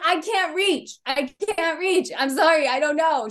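put-on high, pleading little-kid voice; whiny kid me. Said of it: "I'm like".

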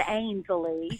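"Ainsley" stretched slowly into extra syllables; slow Charleston drawl. Retold or imitated. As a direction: draw out "Ainsley" in a Charleston accent. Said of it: "It was just that slow".

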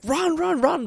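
strained mock yell from a captain; captain urging a run. Captain calling to the motionless horse, high mock yell. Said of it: "The captain's like".

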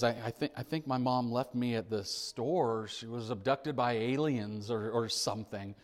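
hushed little admission; quietly. Acted. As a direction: confide it softly and low, like a lost child. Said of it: "just quietly says".

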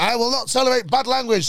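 high, loud mock outrage; outraged owner. Hotel owner to the swearing guest, outraged mock rebuke. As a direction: imitate an indignant telling-off.

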